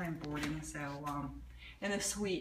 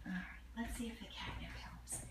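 whisper; 3 semitones higher, 11 dB quieter than usual. Real, not acted. Whispering beside the shy cat.

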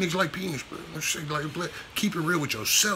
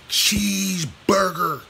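stretched-out mock savoring; 3 semitones higher, 4 dB louder, much slower than usual. Mock ordering a treat for Buck.

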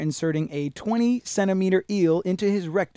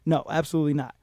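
ordinary delivery

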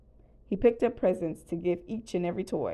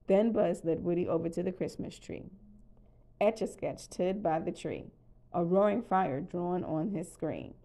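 usual speaking voice

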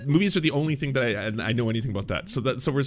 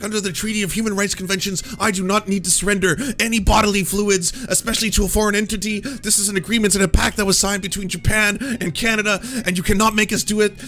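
high, loud indignant tirade; flipping out; 7 semitones higher, 5 dB louder than usual. Retold or imitated. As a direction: rant about your rights at the police. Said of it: "like, flipping out".

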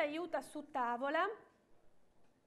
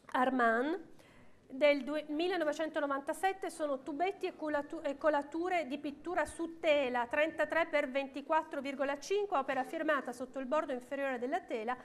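ordinary talk

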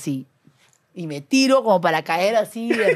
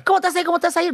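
high, insistent mimicked nagging; typical protesting friend; 10 semitones higher, much faster than usual. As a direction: repeat it like a pestering friend, high-pitched. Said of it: "La típica".

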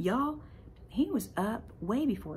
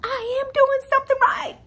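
much higher and louder, laughing through near tears; overjoyed; 11 dB louder than usual. Real, not acted. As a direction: burst out with tearful joy.

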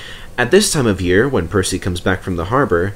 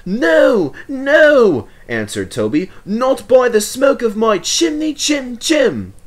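playful defiance; 14 semitones higher, 3 dB louder, slower than usual. Acted. Toby mocking pig rhyme reply.

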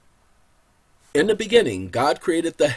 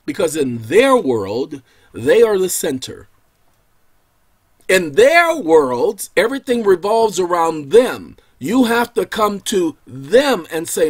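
louder, pressing the point; rising preach; 2 semitones higher, 7 dB louder than usual. Real, not acted. Preacher rails about the powerful's world, louder. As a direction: build it up.

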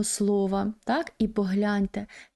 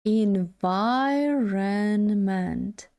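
"Environment" stretched syllable by syllable, second syllable stressed; very slow demonstration; much slower than usual. Shown as a demonstration. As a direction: draw "Environment" right out for learners. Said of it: "наголос на другому складі". (дуже повільно, по складах "Environment")

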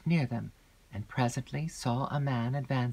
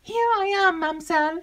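very high, loud servant's voice; maid calling out; 7 dB louder than usual. A narrator's reading. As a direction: pipe up in a high call. Maid calls out while running up.

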